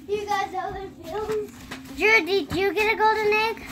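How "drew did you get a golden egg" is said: loud call across the yard. Real, not acted. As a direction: shout to your brother. Kid calls out to brother.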